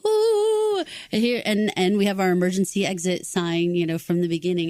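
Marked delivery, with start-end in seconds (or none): 0.1-0.8 high siren whoop. Retold or imitated. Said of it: "and, like".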